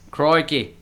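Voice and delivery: broad Australian "croikey"; Australian accent. Shown as a demonstration. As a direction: exclaim it in a put-on Australian accent. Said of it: "That's Australian".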